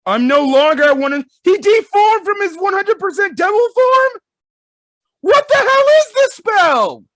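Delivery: high, loud, incredulous yelling; screaming in amazement, voice shot way up; shocked shouting. Real, not acted. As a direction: read it out and erupt into a disbelieving shriek. Shocked reaction while reading the reveal.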